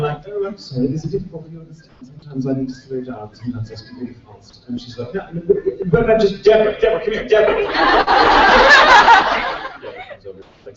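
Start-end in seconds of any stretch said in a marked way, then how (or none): 0.3-4.7 softer, condescending British RP apology; British received pronunciation. Acted. Teasing the puzzled concierge, condescending British RP. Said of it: "And I'm playing along". 5.1-7.6 mock flustered, high-pitched call to a colleague. Concierge summons Deborah, flustered imitation.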